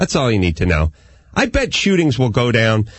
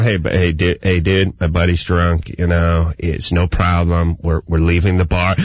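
low-pitched peacemaker voice; smooth things over for a drunk friend; placating buddy; 4 semitones lower than usual. Acted. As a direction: reasonable pal, lightly acted. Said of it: "trying to talk a little sense".

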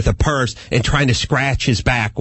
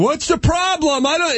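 high-pitched mock-protesting yell; imitated yelling. Man protesting to a cop with gun drawn, imitated yelling. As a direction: yell it like a flustered suspect.

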